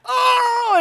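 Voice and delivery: high, loud mock cry of pain; imitating his wife crying out in labor. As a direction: belt out a hammed-up groan.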